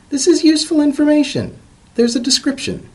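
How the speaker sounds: very high squeaky excitement; gleeful squeal of a find; excited discovery. Acted. Professor thrilled by the book, squeaking high.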